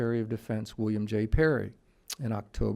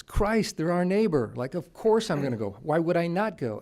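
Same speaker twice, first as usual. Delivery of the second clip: higher, louder Boston general; imitating a blunt Boston general; Boston accent; 6 semitones higher, 5 dB louder than usual. Lecturer quoting the general's Boston reply, higher and louder. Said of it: "He's got this great Boston accent".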